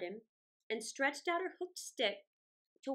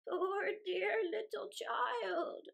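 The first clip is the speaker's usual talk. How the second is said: put-on slow, high, pitying old woman; lightly voiced old woman; 7 semitones higher, much slower than usual. A narrator's reading. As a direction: coo over the child like a kindly old woman. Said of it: "said the old woman".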